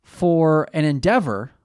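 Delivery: pompous announcer intro; grand announcer. Acted.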